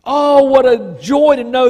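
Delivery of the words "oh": usual speaking voice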